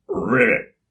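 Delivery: deep loud ribbit; loud frog croak. Acted. Chocolate frog on its lily pad, loud croak.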